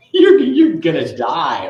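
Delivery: laughing through the words; mock-dramatic line broken by laughter. Real, not acted. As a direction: laugh as you tell it. Laughing through mom's quote.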